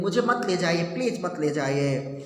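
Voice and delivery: ordinary delivery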